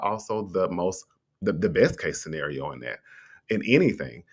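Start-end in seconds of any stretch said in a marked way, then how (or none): none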